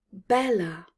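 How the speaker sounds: usual speaking voice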